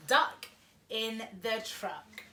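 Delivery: ordinary delivery, nothing marked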